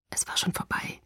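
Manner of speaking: reluctant hushed whisper; grim quiet whisper. Acted. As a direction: breathe it out unwillingly. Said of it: "flüsterte er".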